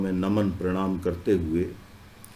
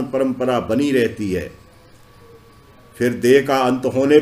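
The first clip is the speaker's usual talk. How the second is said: raised, declaimed reading of sacred verse; devotional scripture reading; 4 semitones higher, 4 dB louder than usual. Acted. Devotee reciting the kavach's blessings to listeners, declaimed scripture reading. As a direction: read it out in measured devotional phrases, projected. Reads scripture translation aloud.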